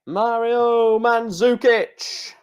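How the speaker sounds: mock announcer hailing the player; drawn-out name call. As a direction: sing the name out like a stadium announcer. Calling out drawn player names.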